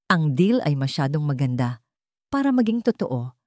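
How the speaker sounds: ordinary delivery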